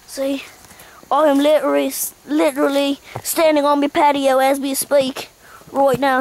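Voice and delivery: mock Aussie guide surveying the wreckage; forlorn Australian survival host. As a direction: report the ruin with put-on gloom. Australian host shows his wrecked house.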